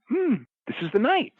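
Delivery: high, pondering voice for a retold thought; musing promoter. Retelling promoter watching the fans, high musing voice. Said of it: "just going like".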